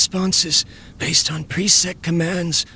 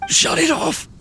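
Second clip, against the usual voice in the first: higher, sharp urgent order; urgent command; 11 semitones higher than usual. Acted. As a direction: push the order out in alarm. Urgent order as strength fails.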